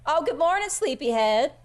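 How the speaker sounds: high, loud cheery wake-up; chirpy, motherly morning call; cooing Skinny. Acted. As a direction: greet a sleeper like a doting mom. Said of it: "She goes".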